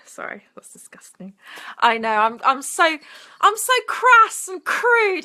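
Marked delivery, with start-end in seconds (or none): 0.1-1.4 much softer, a breathy sheepish whisper; whispered apology. Real, not acted. Streamer apologising to viewers for a crude joke, whispered. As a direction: drop to a whisper, half embarrassed.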